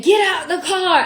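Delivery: high, loud police command; mock barked order. The officer ordering her out, a loud mock command. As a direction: bark it like a cop. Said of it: "officer's command quoted".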